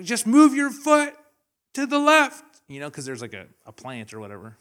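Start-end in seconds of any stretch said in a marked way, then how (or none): 0.0-2.6 high, loud, slow instructions; 1 teacher calling up. Retold or imitated. Teacher guiding a student down the slope, loud. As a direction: call each step up the hill. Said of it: "he's like".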